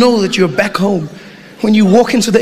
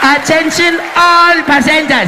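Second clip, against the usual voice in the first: put-on higher, slow, drawn-out South African accented call; South African announcer; 5 semitones higher, slower than usual. Comic imitating a Johannesburg airport announcer. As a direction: stretch each word like a bored local announcer. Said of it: "she'll come and be like".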